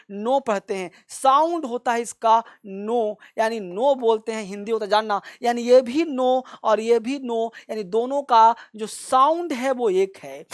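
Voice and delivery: usual speaking voice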